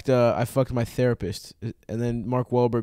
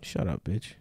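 low, quiet, in earnest; dropped low, no joking; real put-down; 6 semitones lower, 10 dB quieter, slower than usual. Real, not acted. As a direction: say it softly and mean it. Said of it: "That one was real".

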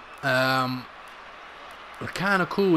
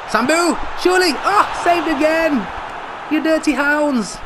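high, loud cry at a near miss; real frustrated shouting; excited shout; 12 semitones higher, 4 dB louder, slower than usual. Real, not acted. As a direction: yell at the keeper's saves. Near-goal saved, frustrated.

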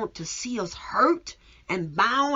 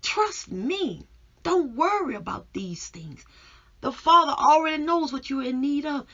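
raised, emphatic voice of divine reassurance; Jesus; 6 semitones higher, 5 dB louder than usual. Acted. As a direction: preach it higher and louder, urging trust. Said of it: "this is why he says".

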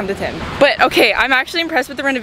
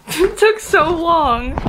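laughing; 8 semitones higher, much slower than usual. Real, not acted. Laughing after long outfit change.

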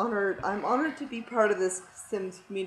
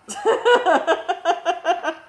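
high, loud giggling fit; laughing; 10 semitones higher, 8 dB louder than usual. Real, not acted. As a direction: crack up at your own silliness. Laughs at own bad accent.

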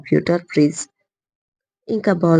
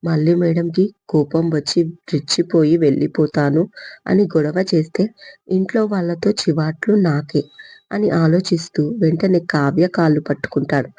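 usual speaking voice

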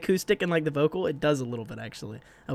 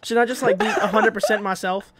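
laughing, higher and louder; 7 semitones higher, 5 dB louder than usual. Real, not acted. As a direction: giggle through the whole question.

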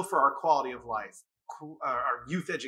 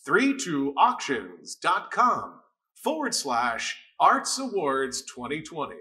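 radio voice; 4 dB louder, slower than usual. Shown as a demonstration. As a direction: read it like a radio DJ. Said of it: "I'm gonna do it in radio voice".

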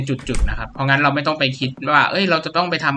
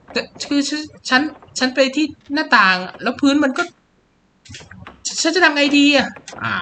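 put-on high, stammering scared girl voice; high stuttering girl on the edge of panic; frightened girl; 13 semitones higher than usual. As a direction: play a panicked girl stuck by the window. Voicing frightened Sissy.

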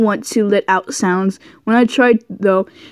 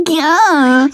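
surprised yelp; 5 semitones higher than usual. Acted. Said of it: "I yelled in surprise".

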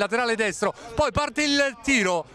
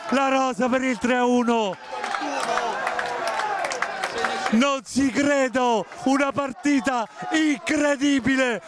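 breathless italian goal commentary. Real, not acted. Goal sealing the final.

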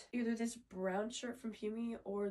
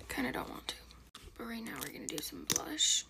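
whispering; 5 dB louder than usual. Real, not acted. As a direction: keep it all in a whisper. Whispers the whole video.

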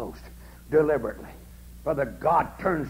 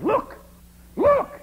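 high, loud amazed cry; preacher retelling a man's amazed yell at sunset; excited yell; 7 dB louder than usual. Retold or imitated. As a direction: shout it out in wonder at the light. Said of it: "Suddenly that man yelled or screamed and said".

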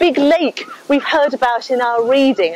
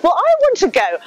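higher-pitched eager disciple; eager Peter; 8 semitones higher than usual. Acted. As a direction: jump in keenly like an eager follower. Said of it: "so Peter says".

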